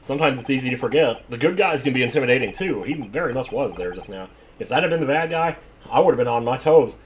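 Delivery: usual speaking voice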